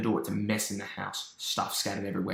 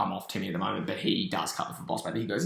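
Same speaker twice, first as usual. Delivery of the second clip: ordinary delivery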